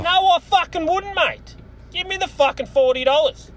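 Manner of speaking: high, pushy mock outburst that he then takes back; a hammed-up snap at a haggling buyer; aggressive retort. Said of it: "I wasn't that aggressive".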